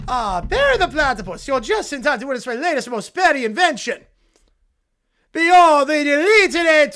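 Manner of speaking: loud, hammed-up evil scientist monologue; cartoon villain. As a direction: gloat to your nemesis like a cartoon mad scientist. Plays Doofenshmirtz reading AI script.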